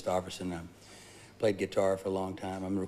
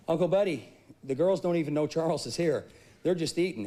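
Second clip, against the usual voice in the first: higher, louder mock yell across the farmyard; yelling to uncle; 7 semitones higher, 4 dB louder than usual. A boy calling his uncle from the hog trough, yelling. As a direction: call out to the uncle across the pen. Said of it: "I yelled to my uncle".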